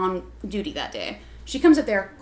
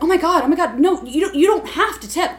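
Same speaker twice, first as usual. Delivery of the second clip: higher, louder imitation of a reassuring manager; mock fussing, pitched up; flustered manager; 6 semitones higher, 5 dB louder than usual. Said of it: "So my manager's like".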